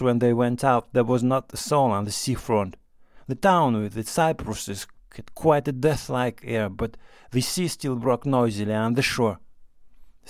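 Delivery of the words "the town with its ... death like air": usual speaking voice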